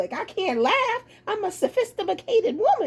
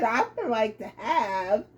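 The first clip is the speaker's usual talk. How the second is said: lower, quieter, stalled "I would like to have"; husband freezing as he orders, lower and softer; low halting husband; 9 semitones lower, 4 dB quieter than usual. Retold or imitated. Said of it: "he said".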